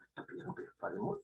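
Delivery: hushed incredulous student murmur; mock whispered aside. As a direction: whisper it to the student beside you. Students muttering about the request.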